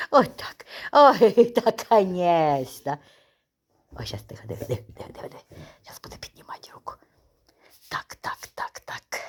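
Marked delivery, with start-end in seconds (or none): 4.0-9.2 hushed whisper. Real, not acted. Owner whispering while waiting for the dog to raise its paw. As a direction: whisper so the dog won't notice.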